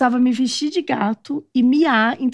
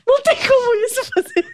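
high, laughing words; giggling through the line; 12 semitones higher than usual. Real, not acted. Rindo da história. (fala rindo, em tom alto)

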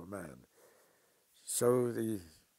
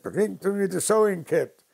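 high, loud command; loud order; 10 semitones higher, 11 dB louder than usual. Retold or imitated. Uncle orders his wife to fetch the sewing kit, loud. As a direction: give the order loudly.

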